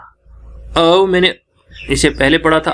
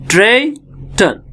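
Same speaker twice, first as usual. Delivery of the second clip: "ट्रेटन" slowly split, high first syllable; two syllables apart; 7 semitones higher, slower than usual. Shown as a demonstration. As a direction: lift "tray", pause, drop on "tun". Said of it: "दो syllable हैं". ("ट्रेटन" दो हिस्सों में, धीरे)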